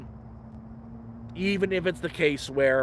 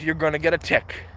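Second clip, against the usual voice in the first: normal talking voice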